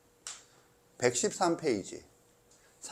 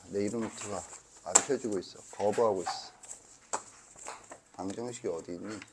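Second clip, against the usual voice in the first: usual speaking voice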